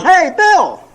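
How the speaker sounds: high-pitched hollered greeting; hollering. Retold or imitated. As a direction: holler it across the mall, imitating a caller. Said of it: "And he hollers".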